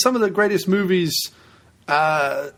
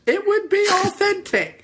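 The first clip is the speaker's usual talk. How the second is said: high, mock northern voice; silly put-on voice. Host defends his voice for the movie, silly high voice. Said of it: "if I talked like that".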